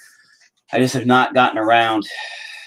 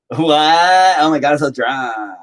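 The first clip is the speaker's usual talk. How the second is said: put-on higher, louder drunk club girl; a hint of a drunk girl at the bar; mock drunk girl; 6 semitones higher, 4 dB louder, slower than usual. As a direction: whine it like a tipsy girl.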